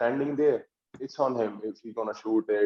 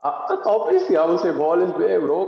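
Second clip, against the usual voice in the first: put-on Indian accent; 7 semitones higher, 6 dB louder than usual. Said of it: "what is your favorite N B A podcast in Indian accent".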